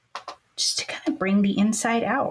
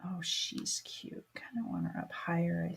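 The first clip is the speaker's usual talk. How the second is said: whispering; 3 semitones lower, 11 dB quieter than usual. Real, not acted. Crafter admiring card, whispering.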